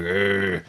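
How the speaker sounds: gruff hot dog vendor grunting at customers. Retold or imitated. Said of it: "you hear this gruff voice".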